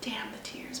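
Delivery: whispered, holding back tears; tearful whisper. Real, not acted. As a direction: breathe it out past the tears. Overcome recalling the birth.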